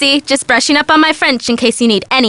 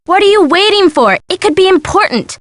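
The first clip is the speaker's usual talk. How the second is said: higher-pitched, insistent urging; urgent prodding; 5 semitones higher than usual. Acted. Friend urges the sleuth to go help him, urgent prodding. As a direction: push your friend to get moving.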